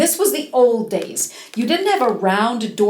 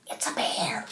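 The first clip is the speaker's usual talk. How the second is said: whispered in fright, much lower and softer; frightened whisper; 12 semitones lower, 11 dB quieter than usual. Acted. Rose Red whispering to her mother about the bear. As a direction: breathe it so the bear won't hear. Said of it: "said Rose Red".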